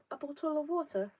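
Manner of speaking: mock posh English bottle of water; exaggerated British accent. Shown as a demonstration. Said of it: "No, I just say bottle of water".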